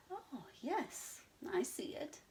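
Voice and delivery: high hushed excitement; excited moo. Acted. Abigail spotting the star cow, excited. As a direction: breathe it out excitedly. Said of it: "she mooed excitedly".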